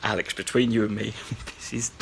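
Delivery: hushed, half-laughing aside; stifled laughter. Real, not acted. Host chuckling under his breath at the clip. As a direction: whisper it while trying not to laugh.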